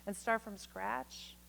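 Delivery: soft, measured poetry reading; poem reading. Acted. Reading a love poem to his wife, soft and measured. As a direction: read it quietly to your wife.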